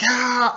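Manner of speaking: high, loud "ah" cry; imitated battle yell. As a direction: mimic a fighter's charging yell.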